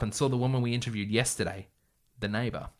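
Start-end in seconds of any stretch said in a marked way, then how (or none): none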